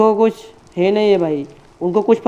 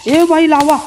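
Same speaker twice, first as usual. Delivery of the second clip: high, loud startled call; sudden alarmed cry at lava; excited alarm; 7 semitones higher, 3 dB louder than usual. Real, not acted. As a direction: yelp a warning about the lava. Spots lava in the game.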